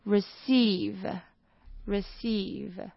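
"receive" drawn out, with a long E in "-ceive"; teacher drilling "receive"; very slow model pronunciation. Shown as a demonstration. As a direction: stretch "receive" for learners. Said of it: "C E I这边是发长音".

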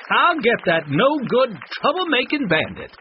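high, loud theatrical threat; punchy Wild West show yell; Wanda yelling. A narrator's reading. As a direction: holler after a fleeing outlaw, drawing out the words. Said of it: "Wanda yelled".

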